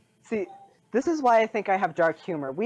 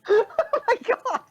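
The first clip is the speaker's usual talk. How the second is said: helpless laughter; 6 dB louder, slower than usual. Real, not acted. Helpless laughter at dark joke.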